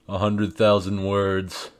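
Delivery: ordinary talk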